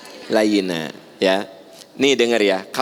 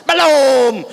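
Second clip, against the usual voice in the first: high, loud, curt no; child shouting back at mom; snapped reply; 13 semitones higher, 7 dB louder than usual. Retold or imitated. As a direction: bark the answer back. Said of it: "Jadi langsung ngegas".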